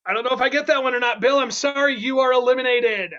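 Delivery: ordinary delivery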